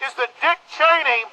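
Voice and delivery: rally the crowd through a megaphone, full force; fiery street-corner sermon through a bullhorn; megaphone protest speech. Real, not acted.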